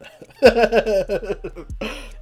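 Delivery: loud, high giggling fit; laughing. Real, not acted. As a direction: crack up mid-story. Laughs at his story.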